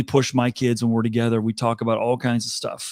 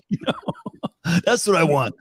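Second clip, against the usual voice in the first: higher-pitched, just out of a laugh; amused; 6 semitones higher, faster than usual. Real, not acted. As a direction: say it through leftover laughter. Laughing at own phone story.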